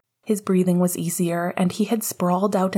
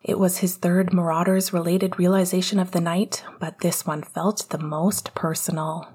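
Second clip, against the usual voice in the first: plain reading voice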